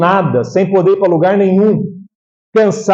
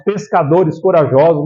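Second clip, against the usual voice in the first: ordinary delivery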